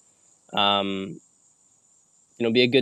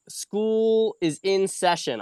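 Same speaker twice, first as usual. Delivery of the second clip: high, slow, drawn-out announcement; mock teacher opening class; announcing class; 8 semitones higher, much slower than usual. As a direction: ring the school bell with your voice. Opening her wine school lesson.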